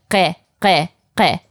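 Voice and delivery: ghe repeated with a throaty gh, separated by pauses; Persian gh drill. Shown as a demonstration. Teacher drilling the sound for practice, throaty ghe each time. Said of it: "you just have to keep practicing this".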